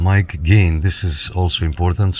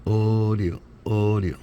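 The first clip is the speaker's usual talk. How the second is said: drawn-out level test word, held on one note; mic test call; 4 semitones higher, 4 dB quieter, slower than usual. Shown as a demonstration. Radio host keys up to check the meter, mic test call. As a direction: sustain each word evenly into the mic. Testing the radio's audio on key-up.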